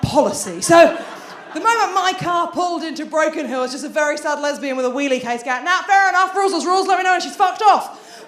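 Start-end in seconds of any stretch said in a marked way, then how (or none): 5.6-8.3 a louder, higher hammed-up sigh of defeat; put-upon grumble; mock resigned.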